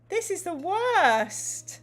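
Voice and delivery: high, slow, hammed-up wail; mock despair. Host groaning at the gamebook's forced fight, in mock despair. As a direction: moan it out in theatrical dismay.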